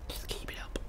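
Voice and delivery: breathy voiceless whisper of "Just keep it up". Shown as a demonstration. Streamer to a viewer, cheering them on in a whisper. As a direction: drop to a hushed whisper for the viewer. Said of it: "same words, whispered".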